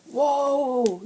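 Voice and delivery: high, loud startled whoa; startled yell. A narrator's reading. Boys yell as the floe pitches, startled. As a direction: cry out as the ice pitches. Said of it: "yelled all three boys".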